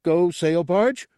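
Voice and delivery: high, slow, loud rising question; childlike eagerness in a high voice; hopeful. A narrator's reading. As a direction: ask eagerly, stretching it upward. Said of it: "Gartog repeated hopefully".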